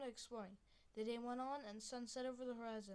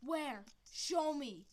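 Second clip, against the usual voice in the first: urgent; 4 semitones higher, 10 dB louder, much slower than usual. Acted. Adult urgent reaction.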